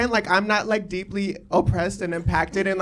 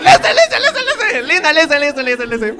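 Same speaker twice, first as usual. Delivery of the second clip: laughing; 5 semitones higher, 9 dB louder, faster than usual. Real, not acted. Laughing, calling crowd to listen.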